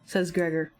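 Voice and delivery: ordinary talk, nothing marked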